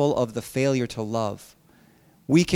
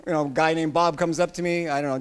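ordinary delivery

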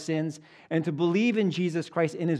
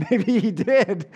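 laughing at his own joke, higher and louder; 7 semitones higher, 6 dB louder, slower than usual. Real, not acted. Preacher laughing at his squeaky Pharaoh voice. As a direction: giggle through the words.

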